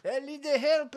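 high, loud, hammed-up scared yelp; mock terror. Scared Frenchman pressing buttons in panic, hammed-up yelp. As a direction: ham up a terrified passenger.

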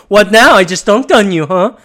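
loud, gloating jeer; cocky taunting jeer, pushed up loud; mocking taunt. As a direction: rub it in their faces. Acted taunt from countries.

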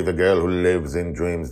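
plain reading voice

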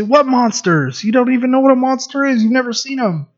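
light, high fatherly teasing; playful dad voice. Retold or imitated. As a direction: gently laugh off their fear. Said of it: "And I say".

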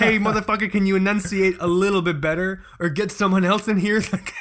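high, indignant, cracking up with laughter; hammed-up complaint breaking into laughs; laughing mock demand. Son's imagined demand to the lisping doctor, laughing indignation.

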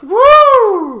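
loud, very high "woo"; mock cheering whoop. Host cheering Irish cops in jest, high whoop. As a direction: whoop it up like a rowdy crowd.

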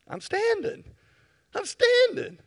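very high, loud cry; excited shout. Acted. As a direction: proclaim it triumphantly. Preacher stands, shouting.

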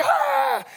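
short high yelp of fright; Herbie's high scream of fright; frightened scream. Acted. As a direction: shriek as the talons come. Hawk's talons come out.

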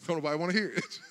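talking through real laughter; laughing aside. Real, not acted. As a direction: laugh while you say it. Laughing at own joke.

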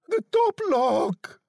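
high, slow woman's call; desperate loud cry. A narrator's reading. Wife at the locked door calls husband, desperate cry. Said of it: "she cried loudly".

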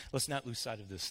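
normal talking voice

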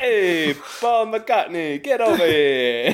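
put-on New York accent, laughing through it. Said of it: "New York accent".

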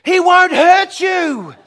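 slow, loud shout from afar; calling out. Retold or imitated. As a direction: yell across to a stranger, drawn out. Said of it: "he calls out to me".

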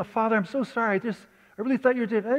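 blurting out how sorry he is; eager apology. Retold or imitated. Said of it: "But I'm saying".

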